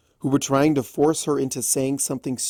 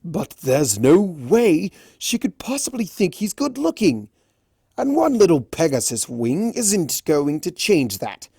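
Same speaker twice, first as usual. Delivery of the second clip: higher, louder indignant stallion; shouted back; 6 semitones higher, 5 dB louder than usual. A narrator's reading. Raspberry shouting back at Applejack, indignant. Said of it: "Raspberry shouted back".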